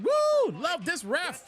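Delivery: high, slow cheering fan; hammed-up delighted fan cheer; mock cheering fan. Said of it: "no fan is like".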